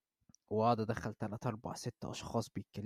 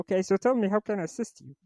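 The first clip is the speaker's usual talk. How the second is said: higher, slow Texan supervisor voice; changed voice; 9 semitones higher, 6 dB louder, much slower than usual. Retold or imitated. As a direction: drawl like the fake boss. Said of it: "قلت لها".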